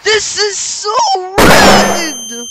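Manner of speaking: high, loud, drawn-out protest; anguished gamer shout; frustrated wail. Real, not acted. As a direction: scream at the unfairness of it. Frustrated by a game loss.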